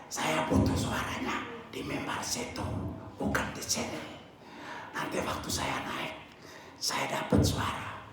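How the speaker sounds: breathless husk of a voice; hoarse whisper. Retold or imitated. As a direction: voice almost gone, all breath. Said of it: "Suara Pak Max sudah seperti begitu".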